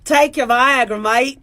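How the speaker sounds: playful put-on Australian accent. Shown as a demonstration. Doctor advising a patient, Australian accent. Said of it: "or Australian".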